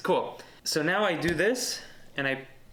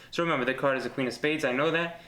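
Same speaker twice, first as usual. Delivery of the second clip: usual speaking voice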